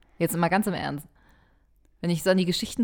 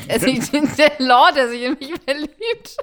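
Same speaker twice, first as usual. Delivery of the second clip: laughing; 8 semitones higher, 10 dB louder, slower than usual. Pretend. Giggling through romantic fantasy.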